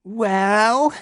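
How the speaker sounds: high cawing question; crow voice. Acted. Crow Freya demands news, cawing high. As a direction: caw it like a crow. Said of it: "she carked".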